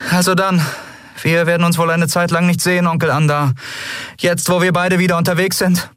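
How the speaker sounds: high-pitched character voice; Endriel bidding farewell. Acted. Endriel saying goodbye to her uncle, high voice.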